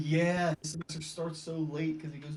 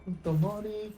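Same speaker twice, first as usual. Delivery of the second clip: bank robber; 5 semitones higher than usual. Acted. Mock bank heist in game.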